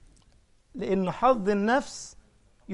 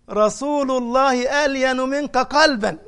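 higher, louder, slow teasing reply; lightly voiced wives' retort; 5 semitones higher, 5 dB louder, slower than usual. The wives answering Omar that the Prophet is gentler, teasing. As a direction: answer back as the women would.

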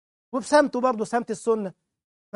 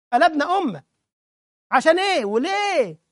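heated preaching outburst; 6 semitones higher, 6 dB louder than usual. Real, not acted.

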